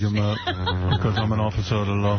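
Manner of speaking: laughing mock cop voice. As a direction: play a proud cop. Imitating Shaq as cop.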